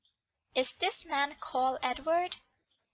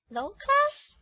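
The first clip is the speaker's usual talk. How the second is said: much higher, louder indignant echo; outraged echo; 11 semitones higher, 5 dB louder than usual. Acted. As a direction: spit the insult back in shock. Girl repeats the insult.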